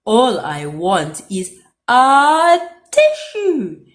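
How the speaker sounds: slow, loud snuffly plea; stuffy wolf. A narrator's reading. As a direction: drag it out through a blocked nose. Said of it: "sniffed Big Bad".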